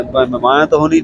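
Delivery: normal talking voice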